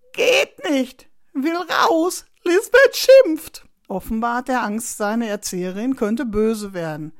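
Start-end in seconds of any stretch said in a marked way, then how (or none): 0.1-2.3 put-on high, loud child's wail; upset child in rising panic; distressed little boy. A narrator's reading. Trapped boy cries to get out. 2.4-3.7 very high, loud, slow wail; drawn-out high-pitched cry of dread; panicked child. A narrator's reading. Boy panics about teacher scolding.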